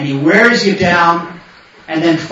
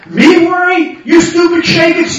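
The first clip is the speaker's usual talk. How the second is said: high-pitched mock outrage; hammed-up scolding comeback; indignant retort; 9 semitones higher, 3 dB louder than usual. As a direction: throw the worry back, high and scornful. Acted retort cursing the Yetzer.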